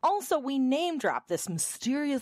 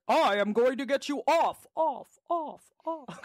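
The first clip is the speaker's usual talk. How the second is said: higher hype voice with fading echo; mock announcer in bed; 5 semitones higher than usual. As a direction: announce the dirty talk like an ad. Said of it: "talk to me like that in bed".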